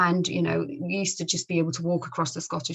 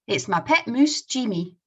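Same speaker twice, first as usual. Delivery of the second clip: louder Scottish voice, MOOSE for mouse; Scottish accent; 5 semitones higher, 6 dB louder than usual. Acted. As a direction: say "moose" the Scots way, with a long oo. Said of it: "I'll try my best to read them using the Scottish accent".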